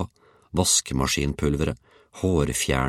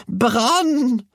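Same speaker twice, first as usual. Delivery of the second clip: very high, stretched scream; old woman's howl; 4 dB louder than usual. A narrator's reading. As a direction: howl the word out long and high. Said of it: "hylte den gamle kona".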